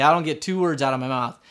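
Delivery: ordinary delivery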